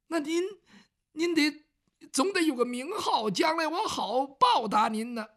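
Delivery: a hint of a humble commoner pressing for a name; eager grateful man. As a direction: reach up high, begging to know his saviour's name.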